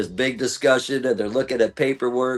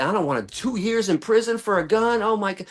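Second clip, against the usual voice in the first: panicked; 8 semitones higher than usual. Retold or imitated. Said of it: "I'm freaking out".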